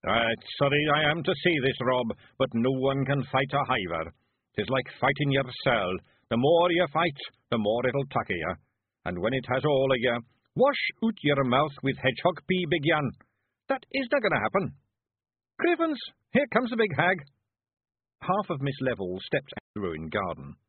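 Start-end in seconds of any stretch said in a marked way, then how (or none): 0.0-13.1 louder, higher Scots voice, regretful; solemn warning in broad Scots; grave Feegle. Acted. Feegle warning Rob about fighting a hiver, grave. 13.7-17.2 very high, loud Scots exclamation; alarmed Feegle. Acted. Feegle warning the others the hag comes, alarmed.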